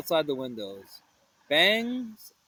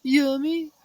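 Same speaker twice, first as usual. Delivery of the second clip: Shaggy voice; 9 semitones higher, 4 dB louder than usual. Acted. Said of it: "said Shaggy".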